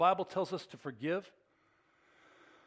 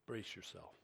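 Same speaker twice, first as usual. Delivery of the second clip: ordinary delivery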